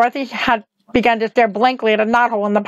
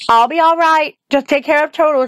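put-on high, earnest girl's voice; reassure, then entreat; brave, pleading Dorothy; 5 semitones higher than usual. A narrator's reading. Dorothy entrusts Toto to Lear, pleading.

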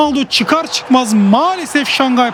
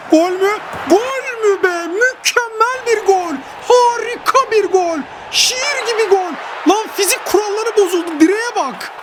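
much higher, cries out in disbelief then slows to savour it; ecstatic goal celebration; 8 semitones higher than usual. Real, not acted. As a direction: celebrate like you just scored the goal of the season.

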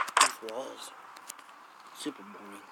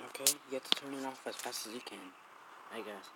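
hushed voice; 11 dB quieter than usual. Real, not acted. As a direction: keep it hushed, someone's sleeping. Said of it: "my voice sounds like I'm sick".

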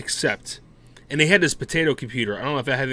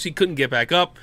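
ordinary delivery

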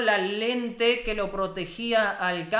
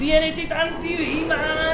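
high, slow, stretched-out cry; awed celebration; 5 semitones higher, 3 dB louder, much slower than usual. Real, not acted. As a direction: draw the words out in triumph. Celebrates the hero's arrival. (grito de júbilo alargado)

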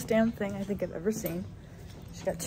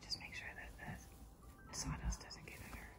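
whispering; 19 dB quieter than usual. Real, not acted. Whispering near newborn animal.

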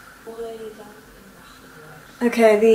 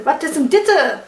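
Berlin accent; 4 semitones higher than usual. Shown as a demonstration. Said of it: "Okay, Berlin".

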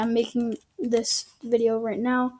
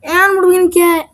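loud, higher eager question; excited anticipation; 5 semitones higher, 10 dB louder, faster than usual. Real, not acted. Gamer hyping viewers before a reveal, loud and eager. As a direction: build the suspense out loud.

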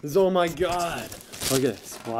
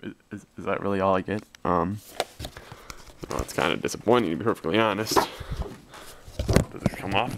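lower, deflated mumble; disappointed; 7 semitones lower, 4 dB quieter than usual. Real, not acted. Unboxer finding little inside, deflated. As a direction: let the letdown show.

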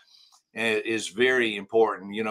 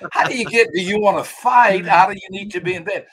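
higher, louder baffled question; incredulous outburst; 7 semitones higher, 7 dB louder than usual. Retold or imitated. To his friend, loud baffled disbelief. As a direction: push back in loud disbelief.

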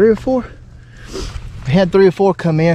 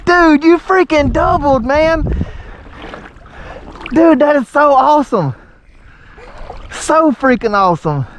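elated; 7 semitones higher than usual. Real, not acted. Father celebrates child's double.

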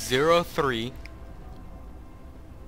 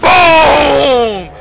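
sudden explosive yell, much higher and louder; triumphant shout; 11 semitones higher, 13 dB louder than usual. Real, not acted. Streamer landing a kill on the enemy, triumphant shout. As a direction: slam the word out like a victory punch.

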